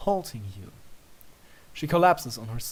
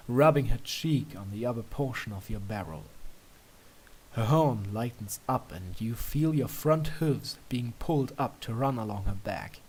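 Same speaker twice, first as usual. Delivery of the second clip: ordinary delivery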